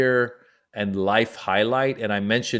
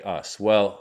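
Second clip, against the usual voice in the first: normal talking voice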